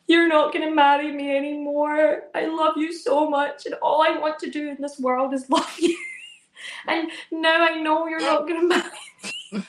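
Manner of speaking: imitating a sobbing child. Son crying to mum about marriage, mock sobbing. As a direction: wail it like a heartbroken kid, half-hammed. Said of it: "Josh is sobbing".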